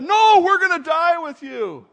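loud, high group vow; emphatic protest. Acted. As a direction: protest it loudly, stretching the 'No'. Disciples' acted vehement vow.

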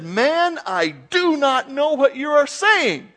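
loud, high, slow acted protest; emphatic denial. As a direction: deny it forcefully, drawing the words out. Acting out Peter's heated denial.

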